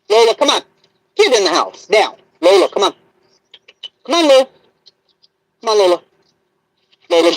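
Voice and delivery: loud, high calls to the dog; calling the dog. Real, not acted. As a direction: call her in from across the yard.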